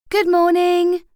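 high, loud sing-song trill. A narrator's reading. Fran greeting her hungover friend, bright trill. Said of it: "trilled Fran".

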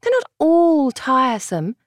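gently protesting sister voice. A narrator's reading. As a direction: protest lightly, slowly. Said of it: "Cassandra had protested".

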